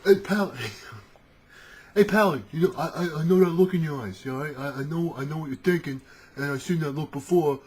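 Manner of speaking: high, insistent rogue warning his pal. Acted. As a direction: urgently talk a friend out of it. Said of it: "The rogue, for example".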